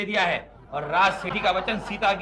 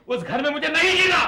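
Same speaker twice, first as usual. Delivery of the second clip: high melodramatic outburst; put-on desperation; 7 semitones higher, 4 dB louder than usual. Husband faking a suicide threat before his wife, loudly melodramatic. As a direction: build to a loud, high final word. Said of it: "आप drama करके तो देखिए".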